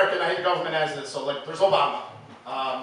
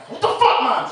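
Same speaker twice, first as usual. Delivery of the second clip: shocked yell at mom; 7 semitones higher, 4 dB louder than usual. Retold or imitated.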